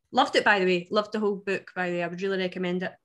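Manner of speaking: usual speaking voice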